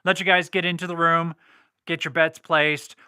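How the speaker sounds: usual speaking voice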